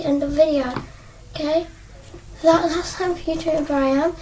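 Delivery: ordinary talk, nothing marked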